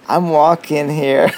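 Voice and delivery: slow, loud mock New York accent. Quoting the New Yorker line for his co-host, slow loud New York accent. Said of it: "that's what they say".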